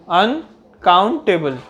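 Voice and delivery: Uncountable slowly, in separate chunks अन, count, table; split into syllables. Shown as a demonstration. Teacher repeating the breakdown of uncountable, syllable by syllable. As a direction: break uncountable up with short stops between syllables. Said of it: "अन में एक आवाज़ आ रही है".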